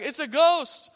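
high, loud yelp of alarm; mock terrified cry. Confused disciples to each other, mock terrified. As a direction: act out exaggerated panic. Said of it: "the disciples are terrified".

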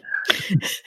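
soft, voiceless laugh; short hushed chuckle to oneself; real chuckle. Real, not acted. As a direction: laugh quietly, almost without voice. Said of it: "why am I laughing at that".

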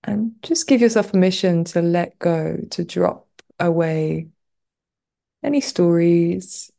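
unhurried meditation guide with long pauses; guided meditation. Real, not acted. Teacher guiding listeners lying on the floor through a body scan, guided meditation. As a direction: ease them into rest, leaving space between phrases.

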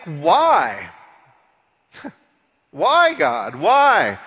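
emphatic, swooping pleas to God; incredulous questioning. Acted. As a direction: demand an explanation from God, mildly hammed-up. Acts out questioning God's choice.

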